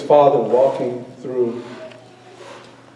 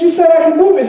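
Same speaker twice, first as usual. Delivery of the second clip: put-on little boy complaining; 11 semitones higher, 4 dB louder, faster than usual.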